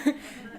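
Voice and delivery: breathy unvoiced laugh; airy laugh at the joke; burst of laughter. Real, not acted. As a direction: let a real laugh escape. Laugh after the comeback.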